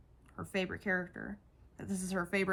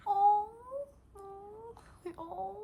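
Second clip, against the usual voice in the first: teary; 12 semitones higher, 5 dB louder, much slower than usual. Real, not acted. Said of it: "These phrases make me wanna cry".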